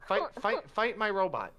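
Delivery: high, loud pestering; pushy high demand, repeating fight; insistent. Acted. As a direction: keep chanting fight, high-pitched and loud. Inventor insists to the guard.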